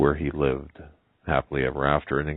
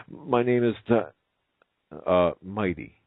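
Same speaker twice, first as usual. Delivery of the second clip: hesitant; 5 semitones higher than usual. Acted. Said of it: "replied the hesitant elephant".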